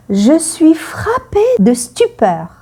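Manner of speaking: high stunned exclamation; mock stunned "Je suis frappée de stupeur"; acted stupor. Shown as a demonstration. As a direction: say it as if struck dumb. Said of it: "quand on est surpris".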